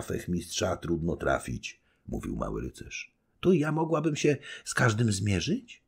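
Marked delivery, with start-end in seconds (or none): 3.4-5.8 put-on higher, bright-eyed girlish question; eager young wife. Young wife asking her husband if she could duel, eager. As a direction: ask as if you could fight them all. Baśka voiced by reader.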